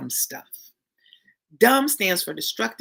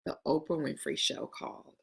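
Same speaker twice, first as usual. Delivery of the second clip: normal talking voice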